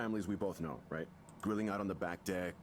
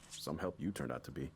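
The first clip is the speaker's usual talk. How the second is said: bitter and dismissive; 6 semitones lower than usual. Acted. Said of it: "He wasn't happy with that".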